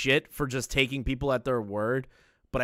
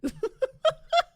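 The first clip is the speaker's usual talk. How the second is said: high laugh; 4 dB louder than usual. Real, not acted. High laugh after impression.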